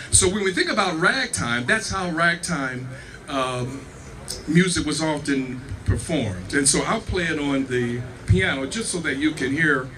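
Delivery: normal talking voice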